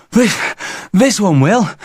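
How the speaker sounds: put-on high, panting boy; winded child protesting; breathless insistence. A narrator's reading. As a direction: stammer it out while out of breath.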